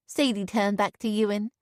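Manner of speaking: ordinary delivery, nothing marked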